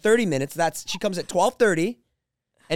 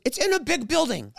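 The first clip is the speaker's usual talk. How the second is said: imitating Susie; 7 semitones higher than usual. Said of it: "Susie goes".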